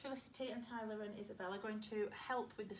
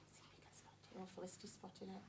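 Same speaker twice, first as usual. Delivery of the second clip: whispering; 3 semitones lower, 13 dB quieter, much faster than usual. Real, not acted. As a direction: whisper it secretly. Teacher whispering to listening children.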